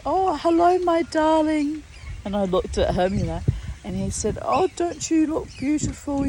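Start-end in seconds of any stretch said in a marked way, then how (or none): 0.1-1.8 high, lilting imitation of a sweet old man; fond imitation of a gentle man; man's sweet greeting. Man greets her warmly in story. 4.4-6.3 high, lilting retold compliment; lightly voiced kindly man; sweet compliment. Retelling the man's compliment.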